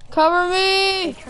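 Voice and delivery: loud, slow, high battle call; cover me shout. Acted. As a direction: bark it out to the squad.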